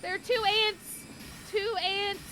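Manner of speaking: high panicked cry; alarmed shriek. Real, not acted. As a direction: yelp it out in a panic. Surprised by giant ants.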